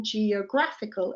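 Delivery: "geographical" with E sounded and stress on GRAPH; shifted stress. Shown as a demonstration. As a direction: say "geographical" as "jee-uh-GRAF-ical". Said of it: "and the stress changes".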